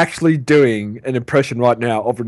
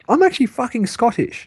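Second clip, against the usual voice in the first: higher-pitched British-sounding protest; British-sounding accent; 10 semitones higher than usual. Real, not acted. Friend insisting on his Scottish roots, British-sounding indignation. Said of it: "That's, that's British".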